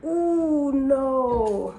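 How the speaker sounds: high, loud disappointed "ooh, no"; mock crowd groan. People in line groan at her wrong answer, high and loud. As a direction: imitate the onlookers groaning at her mistake. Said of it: "the people behind me go".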